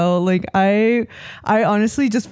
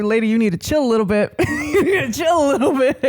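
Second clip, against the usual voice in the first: fast, half-laughing mock scolding; laughing retort; 5 semitones higher than usual. Friend recalls telling the neighbor to chill, laughing. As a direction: tell her off while cracking up. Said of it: "I was like".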